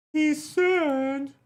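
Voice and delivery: very high, slow squeaky character voice; high put-on character voice. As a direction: squeak it out slowly as a silly stranger. Same squeaky voice.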